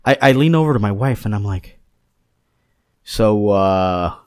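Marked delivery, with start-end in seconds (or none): 3.0-4.1 lightly put-on New York accent.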